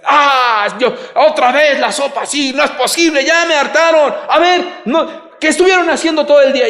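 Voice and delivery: hammed-up furious yelling over cold soup; ranting angry husband. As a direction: explode at the family, yelling and fed up. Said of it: "comienza a despotricar y a gritar".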